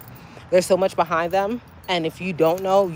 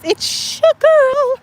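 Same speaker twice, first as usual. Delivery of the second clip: stretched, much higher, louder drawn-out catchphrase; show sign-off; 8 dB louder, much slower than usual. Acted. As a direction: belt out the signature outro slowly.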